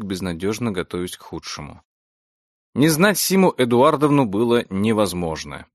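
ordinary delivery

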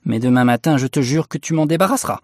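heated warning from an angry wife; angry vow. A narrator's reading. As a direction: swear it crossly to your husband. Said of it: "était si en colère".

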